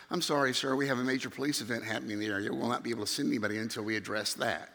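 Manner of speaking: low, soft, fast official patter; police dispatcher. Retold or imitated. Dispatcher turns down an urgent caller, low official patter. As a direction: rattle off the refusal in a low, rote voice. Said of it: "I call the police".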